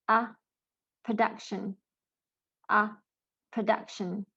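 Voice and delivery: short "uh" alone, then "Production" with that stressed vowel. Shown as a demonstration. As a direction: model "Production" as "pruh-DUK-shun". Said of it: "how these words are supposed to be pronounced".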